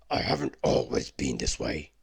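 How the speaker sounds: a beast owning up; beast confessing. Acted. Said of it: "he said".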